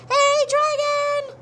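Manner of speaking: drawn-out screaming hey dragon; loud shout. A narrator's reading. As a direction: yell right into the sleeping dragon's ear. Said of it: "shouted as loud as she could".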